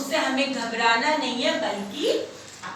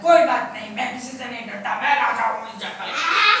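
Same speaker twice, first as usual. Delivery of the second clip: lower, proud lion voice; fearless lion king; 4 semitones lower, 4 dB louder than usual. Acted. Lion brushes off rabbit's warning, proud. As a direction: boast low, with loud bursts. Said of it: "शेर ने कहा".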